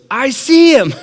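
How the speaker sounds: high, loud hammed-up panic; panicked cry. Terrified friend fooled by the prank, high panicked yell.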